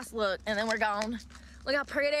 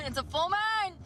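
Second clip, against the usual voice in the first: "moon" sung out high and drawn; excited; 8 semitones higher than usual. Real, not acted. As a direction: lift "moon" up high with glee. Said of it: "Let's get crazy".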